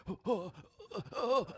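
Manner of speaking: high, soft panicked gasps; mock panic. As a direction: gasp like a dad in shock.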